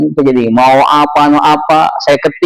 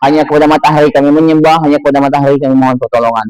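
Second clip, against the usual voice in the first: quick, lower-pitched run-through; rattled off; 4 semitones lower, much faster than usual. Shown as a demonstration. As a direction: reel it off fast and low. Rattles off the mistaken meaning.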